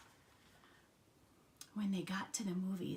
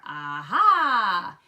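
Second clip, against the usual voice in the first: loud, drawn-out aha; triumphant discovery; 13 dB louder than usual. A narrator's reading. Froggy finding the cookie jar, loud triumph. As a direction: swoop up and down, loudly delighted.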